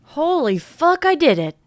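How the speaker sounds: triumphant after prying open the window, thrilled. Acted. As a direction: burst out pleased with yourself. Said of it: "She looks extremely pleased with herself".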